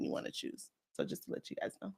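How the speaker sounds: whispered. Real, not acted. Trailing aside at the end.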